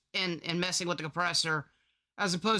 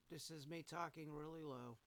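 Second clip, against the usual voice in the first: much softer, low mumble; talking really low; 4 semitones lower, 22 dB quieter than usual. Shown as a demonstration. Replaying his quietest take for the compressor test, talking really low. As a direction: barely voice it.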